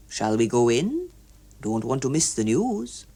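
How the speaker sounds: lightly voiced stiff older man; a hint of a fussy clerk. A narrator's reading. Hughes Evans speaks.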